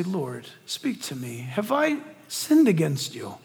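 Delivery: low, very soft plea to God; hushed prayer. Acted. Believer kneeling asks the Lord to speak, hushed plea. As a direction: pray slowly under your voice, then ask gently. Acted prayer on one knee.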